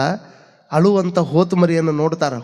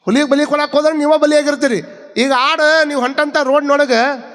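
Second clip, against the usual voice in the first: high-pitched, driving sermon delivery; impassioned preaching; 8 semitones higher than usual. Real, not acted. Preacher exhorting listeners on Basavanna's teaching, impassioned and high. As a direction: preach it insistently, voice held high.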